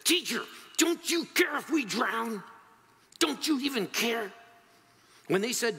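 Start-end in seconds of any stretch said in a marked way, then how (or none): 0.0-4.3 high, urgent cry of accusation; panicked disciples. Acted. Disciples waking Jesus in the storm, desperate high cry. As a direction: plead and accuse at once, pitched way up. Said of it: "The disciples woke him and said".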